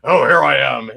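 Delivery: mock the default puppeteer voice; lightly imitated puppet voice; stock puppet voice. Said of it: "or they do the".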